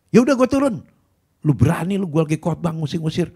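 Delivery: loud, high indignant outburst; angry pastor. Retold or imitated. Pastor shouting at preacher, loud high indignation. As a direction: storm off furious.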